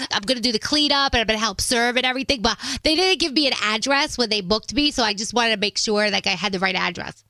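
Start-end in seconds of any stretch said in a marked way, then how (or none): none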